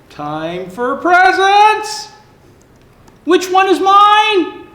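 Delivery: high, slow, excited shouting. Acted. Animals racing to stockings, shouting happily. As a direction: animals crying out gleefully for presents.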